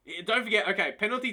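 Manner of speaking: normal talking voice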